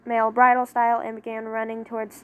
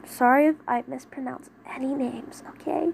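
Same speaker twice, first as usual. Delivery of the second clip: whisper. Real, not acted. Reader's apologetic aside.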